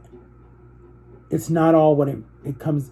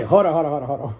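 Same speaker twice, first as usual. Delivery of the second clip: laughing; much faster than usual. Real, not acted. Catches himself mid-rant, laughing.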